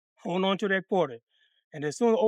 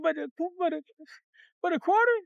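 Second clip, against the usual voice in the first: high, whimpering mock protest; tearful stammer; 6 semitones higher than usual. Boy objects to being paid a quarter, tearful stammering. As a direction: stammer it out in a pitched-up cry. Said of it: "Now I'm crying like a motherfucker".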